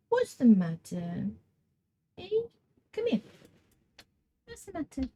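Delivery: soft, mostly high-pitched pet talk; cooing to cat. Real, not acted. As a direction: coax a fussy cat gently. Coaxing and comforting the cat.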